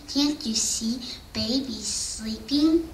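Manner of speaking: breathy whisper; whispered plea. Acted. Reading a lullaby to children, whispering not to wake baby.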